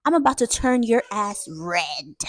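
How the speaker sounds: slow, deliberate mock threat; menacing threat. Grandma warning her son about a whooping, menacing. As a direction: draw out each word as a warning.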